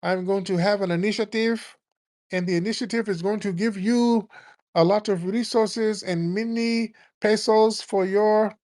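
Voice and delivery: high mocking imitation of a politician's Latin accent, pitched well up. Mocking Kamala's Latin accent at a rally for Latinos. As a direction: play up a put-on Latin accent for a rally crowd. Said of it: "talking in a damn Latin accent".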